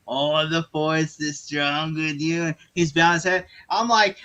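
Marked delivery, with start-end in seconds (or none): 0.1-2.4 slow, drawn-out villain impression; the Emperor. Emperor standing over kneeling Anakin, slow stretched villain voice. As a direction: stretch the words like a gloating Sith master. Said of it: "the Emperor's standing going".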